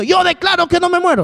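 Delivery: high, insistent mock declaring; mock believer. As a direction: play someone forcing themselves to say they're fine. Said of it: "Si me estoy muriendo".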